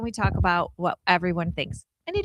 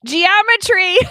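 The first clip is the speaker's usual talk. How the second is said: punchline; 7 semitones higher, 9 dB louder, slower than usual. Real, not acted. Punchline, then repeated.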